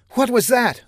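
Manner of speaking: high, startled question; alarmed. A narrator's reading. Woman reacts to the gymnasium shaking, alarmed.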